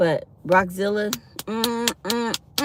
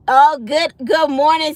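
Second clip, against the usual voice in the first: usual speaking voice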